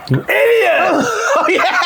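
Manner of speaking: high, laughing shouted insult; mock angry yell. Friend imitating Ben Stiller's rage, laughing yell. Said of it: "you think that one's great?".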